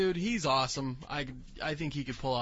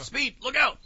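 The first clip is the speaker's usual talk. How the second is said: cartoon hero voice; 10 semitones higher, 8 dB louder than usual. Acting cartoon characters.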